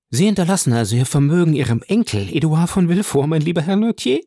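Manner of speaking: höher, schneller, lauernd hoffend; gierig-süßlich nachfragen; hoffnungsvolle Mutter. A narrator's reading. Mutter fragt hoffnungsvoll den Greis. (Frau von Villefort fragt den Greis, höher und schneller)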